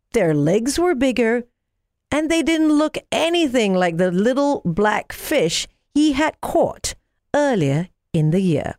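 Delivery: plain reading voice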